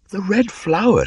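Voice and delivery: put-on very high, loud boyish voice; a boy naming it in wonder; young Mowgli. A narrator's reading. Mowgli repeats the word.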